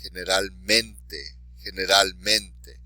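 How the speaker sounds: "Generalmente" slowly, MEN stressed; slow teacher's "generalmente", punching MEN; stress on next-to-last syllable. Shown as a demonstration. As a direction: model "generalmente" twice, leaning on MEN. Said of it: "stress the underlined syllable".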